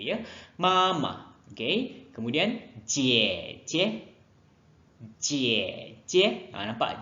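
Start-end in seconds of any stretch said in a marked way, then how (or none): 0.6-1.4 "Mama" high, second "ma" short and toneless; neutral tone demo. Shown as a demonstration. Teacher showing "Mama" with a short ending. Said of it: "Ma belakang tu saya pendekkan sebutan dia". 2.8-6.5 "jie" toned, second "jie" short and light; neutral tone demo. Shown as a demonstration. Teacher drilling "jie, jie" for pupils. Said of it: "Jie belakang tu saya pendekkan sebutan".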